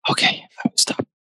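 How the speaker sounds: fully whispered ASMR reply; playful whisper. Pretend. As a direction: whisper it close to the mic. Playful ASMR demo being halted.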